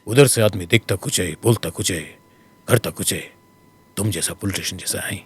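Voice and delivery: low, fast, gruff filmi hero imitation; Amitabh Bachchan voice. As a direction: imitate the famous baritone dialogue delivery. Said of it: "that voice thing".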